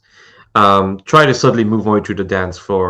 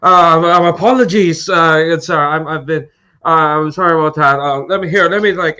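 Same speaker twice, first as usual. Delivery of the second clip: put-on higher, louder bumbling drunk apologizing; 9 semitones higher, 5 dB louder than usual. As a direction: play the stumbling drunk, pitched high and loud. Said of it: "my best drunk voice".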